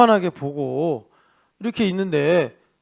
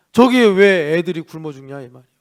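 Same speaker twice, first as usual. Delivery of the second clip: normal talking voice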